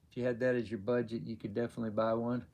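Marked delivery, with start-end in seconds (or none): none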